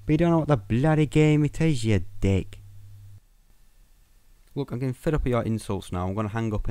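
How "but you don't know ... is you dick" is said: a higher, cheeky put-on Cockney jibe; Cockney insult. Caller insulting Craig in a mock Cockney accent. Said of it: "a poor attempt at a Cockney accent".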